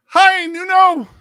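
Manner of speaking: high, slow, loud greeting; calling out. Real, not acted. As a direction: sing out a welcome to a viewer. Greeting viewer on stream.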